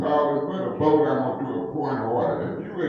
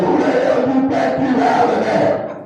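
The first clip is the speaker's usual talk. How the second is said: higher and louder, furious, with YOU punched hard; angry retort; 9 semitones higher, 7 dB louder than usual. Retold or imitated. Councilman confronting the chair who yelled at him, furious shout. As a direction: fire the question back like a challenge. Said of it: "I want to say".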